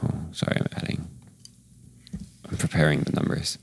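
soft whispered aside. Real, not acted. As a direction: hush it while counting. DM mutters while adding.

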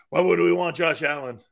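usual speaking voice

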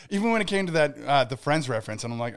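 ordinary talk, nothing marked

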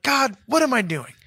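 high, exasperated plea to God; frustrated self-talk. Retold or imitated.

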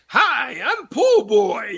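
high, drawn-out mock character voice; hammed-up, slow high greeting; comic video character. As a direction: stretch it out in a high cartoonish voice.

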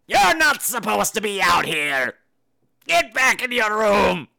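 a hint of a stern old woman, slow; old woman catches a guest in the dark hallway. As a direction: scold the intruder slowly.

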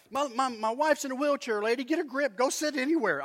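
high, stammering mock outburst; exasperated telling-off. Husband to seat-claiming lady in his head, exasperated. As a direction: let pent-up exasperation spill out. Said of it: "What I wanted to tell that lady".

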